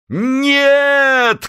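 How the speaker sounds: high shouted no; chorus shout. Acted. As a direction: yell it as a chorus. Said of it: "крикнули крестоносцы любви".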